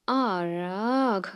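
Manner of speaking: a short exasperated groan; a fed-up growl of a sigh; frustrated groan. Real, not acted. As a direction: let the frustration out in one groan. Frustration at removing kids' shoes.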